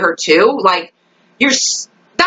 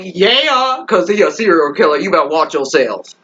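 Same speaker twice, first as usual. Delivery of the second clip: sassy warning; 3 semitones lower than usual. Acted. Fan heckling McCreary's scared men, sassy put-on warning. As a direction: crow the yeah, then warn them.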